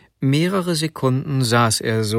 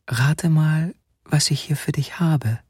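higher, teasing breath of a voice; 4 semitones higher than usual. A narrator's reading. Wife has a surprise for her husband, breathed teasingly. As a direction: breathe it out like a sweet secret. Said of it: "und hauchte".

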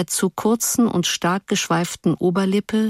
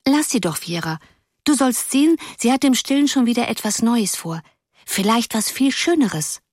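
higher, lightly voiced little girl; little blonde girl; 5 semitones higher than usual. A narrator's reading. Little blonde girl defending her friend, higher and sweet. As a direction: defend your friend sweetly, a touch girlish. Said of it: "meinte ein kleines, blondes Mädchen".

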